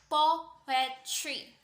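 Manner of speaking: "poetry" slowly split, stress on PO; stress on first syllable. Shown as a demonstration. Lecturer demonstrating a dactyl to students, "poetry" slowly syllable by syllable. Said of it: "stressed syllable falling on the po".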